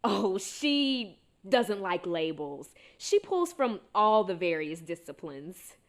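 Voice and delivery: slow, emphatic cover story; improvised lie. Acted. Bluffing friends about a made-up therapist, slow emphatic lie. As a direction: drag out the opening, then state it loudly. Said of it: "And so I said".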